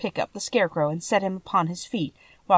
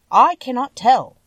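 lightly voiced character, higher and louder; Tin Woodman; 6 semitones higher, 7 dB louder than usual. A narrator's reading. Tin Woodman answers the child, lightly voiced character.

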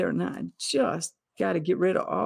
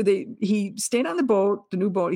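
usual speaking voice

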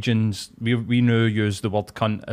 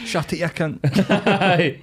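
laughing mock retort; 7 semitones higher, much slower than usual.

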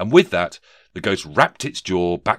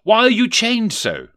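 high, loud, fearful question; trembling question; 8 dB louder than usual. A narrator's reading. Scrooge questions the chained ghost, high and fearful. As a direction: ask it high and alarmed. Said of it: "asked a trembling Rishi Scrooge".

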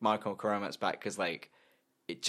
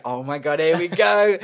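louder, higher hype; excited anticipation; 5 semitones higher, 8 dB louder than usual. Retold or imitated. Reliving the song's build with a friend, excited. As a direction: get pumped for the drop.

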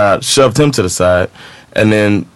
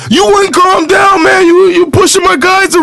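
bouncer yelling. Retold or imitated. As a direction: bark it at the troublemaker.

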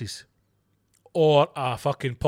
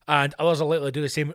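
usual speaking voice

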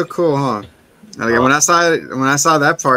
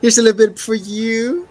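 higher, brisk hammed-up creepy host; mock creepy host; 9 semitones higher than usual. Retelling Mister Hanson serving dinner, hammed-up high voice. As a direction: play the eager creepy host.

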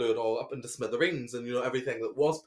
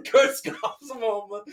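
giggly repeat; 8 semitones higher, 5 dB louder, slower than usual. Real, not acted. Repeating silly name laughing.

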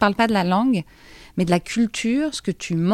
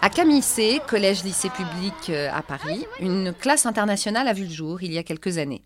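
measured radio reporter narration, softer than in the interviews; news report voice-over; 6 dB quieter than usual. Real, not acted. Reporter presents an international class at a Paris school, measured scripted narration. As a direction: read it as a scripted documentary link.

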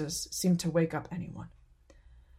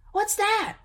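put-on wondering child; 4 dB louder than usual. A narrator's reading. Child sees colored lights.

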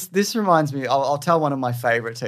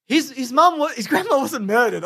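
upset parent; 9 semitones higher than usual. Retold or imitated. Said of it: "they came in and they're like".

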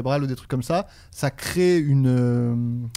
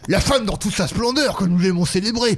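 grandiloquent professor celebrating his muse; professor character; 4 semitones higher than usual. Acted. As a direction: declaim it grandly, chewing the words. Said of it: "Le gars, il mange ses dents". (professeur grandiloquent qui mange ses dents)

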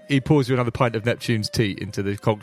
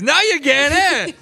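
high, loud, gleeful barman; loud, high, cheerful barman's shout; delighted barman; 5 dB louder than usual. Acted. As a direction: crow it out with delight.

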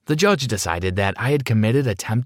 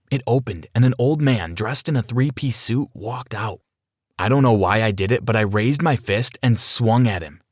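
ordinary delivery

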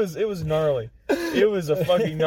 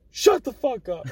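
sergeant's snap; 7 semitones higher than usual. Retold or imitated. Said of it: "And he's like".

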